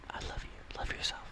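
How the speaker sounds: soft loving whisper, breath only; whispered tenderly. Acted. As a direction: whisper it like a secret kindness. Tender aside after mock insult.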